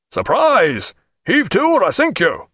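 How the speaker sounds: high threatening hail; shouted reply. A narrator's reading. Captain threatening the strange ship, shouted reply. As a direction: shout back a warning. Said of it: "he replied".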